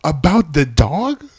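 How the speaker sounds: high, loud, slow incredulous "about the dog"; mock a baffled host who can't believe it; incredulous question. Co-host mimics the bachelorette questioning the contestant, incredulous. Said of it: "the righteous indignation".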